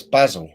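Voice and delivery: "basil" said as BAZ-ul; "basil" rhyming with dazzle, firmly corrected; British pronunciation. Shown as a demonstration. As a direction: say "basil" with a short a, stress first. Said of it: "he says basil".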